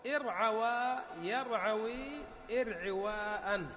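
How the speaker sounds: ارعوى يرعوي ارعواء recited slowly, each form spelled out. Shown as a demonstration. Teacher reciting the verb forms slowly to the class. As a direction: chant the three forms one by one. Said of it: "قال ومنه".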